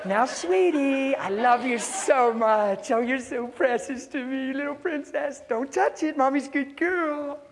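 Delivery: put-on high, slow, gushing baby talk; hammed-up doting mother. Mother to her little princess, gushing mock sweetness. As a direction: overdo a syrupy mommy voice.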